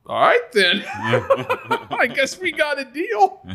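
laughing through the punchline; can't get the words out for laughing. Real, not acted. Telling the punchline of his story to friends, laughing.

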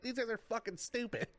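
high, fast, laughing snark; mock announcer scorn. Imitating Joey trashing other wrestlers, high and fast. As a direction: rattle off a snide jab while laughing. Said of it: "where he's like".

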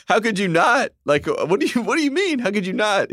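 high, incredulous pitch climbing as he rants; mock incredulous rant. Friend hamming up a TV host's outrage, high incredulous squeal. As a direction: squeal it like you can't believe anyone doubts him. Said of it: "Greenberg starts like".

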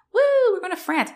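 high, quick whoop of excitement; mock cheering. Students taking off for France, hammed-up cheering. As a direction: imitate a thrilled crowd, high-pitched.

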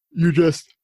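talking through a yawn; words stretched by a real yawn. Real, not acted. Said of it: "sorry, I'm yawning so much this show".

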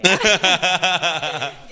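real burst of laughter; loud laugh. Real, not acted. Laughs after mock plea.

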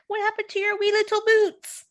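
teasing a fat cat in a high cartoon voice; Shrek impression. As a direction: squeak it out like a mocking Shrek. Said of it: "it's Shrek".

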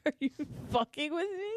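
high-pitched laughing quote; giggly mock disbelief; laughing high retelling. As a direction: repeat it cracking up. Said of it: "I was like".

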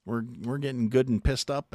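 ordinary delivery

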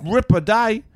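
put-on American trying an Australian accent; American doing Australian. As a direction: ham up a fake Aussie like a Hollywood actor. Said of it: "they'll be like".

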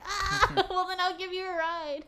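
laughing at the absurd situation as you offer a ride. Retold or imitated. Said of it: "I was like".

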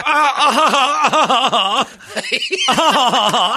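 high cackling laugh. Real, not acted. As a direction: laugh it out in bursts. Laughing after mock proclamation.

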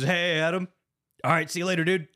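a light send-up of a tape message as friendly chat; Jigsaw, casual parody. Retold or imitated. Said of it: "And he goes".

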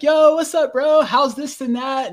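high, loud bro greeting, all buddy-buddy; mock hype greeting. Mocking the expected reunion, high and loud hyped greeting. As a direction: play up an overexcited reunion.

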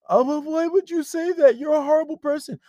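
high, loud mock wail; mock a tearful girlfriend in a high voice; whiny crying girlfriend. Girlfriend accusing boyfriend of being horrible, hammed-up whining.

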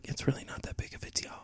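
hushed, quick whisper; imitating a kindly cop under her breath; nice lady cop. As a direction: whisper it as a discreet reassurance. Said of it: "a nice lady cop".

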